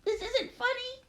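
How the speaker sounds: high, plaintive call that thins away; upset and high, fading toward breathy. Acted. Trapped girl to silent girls above, plaintive high call. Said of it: "I call".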